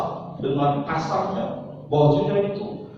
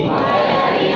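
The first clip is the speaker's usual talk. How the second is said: pledge echo; 5 semitones higher, 7 dB louder than usual. Shown as a demonstration. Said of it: "siap praktek?".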